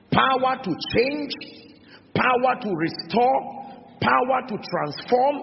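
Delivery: high, ringing list with rising endings; rousing preaching. Real, not acted. Preacher urging the congregation on the gospel's power, rousing. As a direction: punch out each phrase and lift the end.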